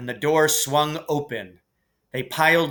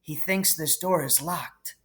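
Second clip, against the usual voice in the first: hushed, softer aside; whispered; 3 semitones higher, 7 dB quieter than usual. A narrator's reading. Harry hiding behind the door from Filch, speaking softer, hushed. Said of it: "Harry whispered".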